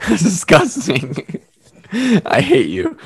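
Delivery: laughing through mock disgust, slow. Real, not acted. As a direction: giggle out the insult.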